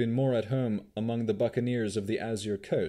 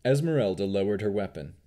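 plain reading voice

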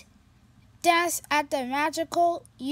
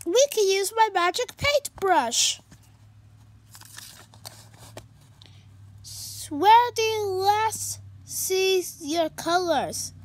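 higher, louder bright cat voice; cheerful kitty voice; 6 semitones higher, 4 dB louder than usual. Acted. Pete greeting his sad friend, high and cheerful.

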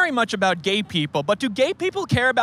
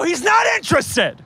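high, raised-voice interruption; exasperated shout; 10 semitones higher than usual. Real, not acted. Host stops guest's pitch to passerby, shouting. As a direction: cut them off with a high yell.

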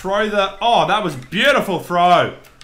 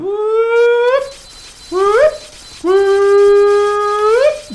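high rising whoops; whooping; 9 dB louder, much slower than usual. Real, not acted. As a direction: cheer like a fan. Cheering in game.

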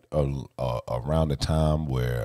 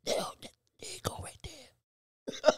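high whispered mock voice; squeaky hushed imitation of the crew; high mock whisper of eager friends; 8 dB quieter than usual.

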